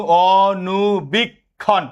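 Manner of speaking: অনুবীক্ষণ stretched very slowly, syllables apart; slow syllable demo. Shown as a demonstration. Teacher slowly sounds out অনুবীক্ষণ so students count syllables.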